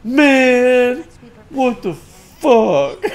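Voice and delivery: loud, high, drawn-out complaint; mock upset. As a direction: imitate a dismayed friend. Retelling friend's upset reaction.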